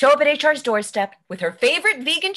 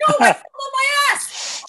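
loud, much higher furious yell; pained angry shout; 13 semitones higher, 4 dB louder than usual. Acted. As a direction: scream it, still hurting from the fall. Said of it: "Are you okay?".

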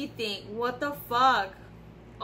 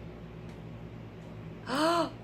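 sharp gasp; 5 semitones higher, 7 dB quieter than usual. Real, not acted. Messed up the spray try.

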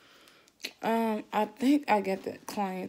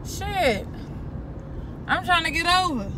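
irritated, raised voice; yelling at a bad driver; annoyed shout at driver; 7 semitones higher than usual. Real, not acted.

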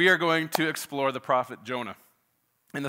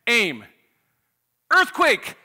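loud, raised-pitch commands, building to a yell; executioner's shout; 7 semitones higher, 8 dB louder, slower than usual. Acted. Executioner to firing squad, cut off by the prisoner, shouted. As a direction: call out the orders, then yell the last word high. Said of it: "the executioner shouts".

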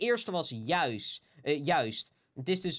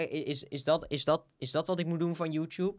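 usual speaking voice

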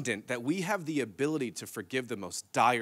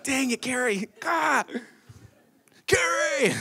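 exasperated; 12 semitones higher than usual. Retold or imitated. Exasperated at wife for exposing him.

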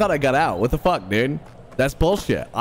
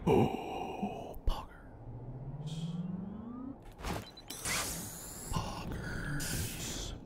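softer, breathy whisper; 5 semitones higher, 15 dB quieter, much slower than usual. Real, not acted. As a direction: whisper it in awe. Hushed reaction to climb.

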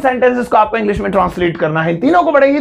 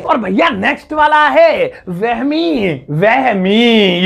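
announcing the next word; much slower than usual. Shown as a demonstration. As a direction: present the next word like a show host. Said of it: "announces next word".

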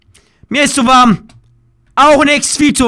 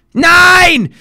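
loud, high cry of frustration; real exasperated outburst; frustrated shout; 6 semitones higher, 4 dB louder than usual. Real, not acted. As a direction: yell it as the throw misses. Frustrated gamer missing a throw.

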